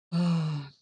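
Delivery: loud fed-up oh; exasperated oh. Retold or imitated. Waiting at the door, calling out a loud fed-up oh. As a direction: burst out with an annoyed oh.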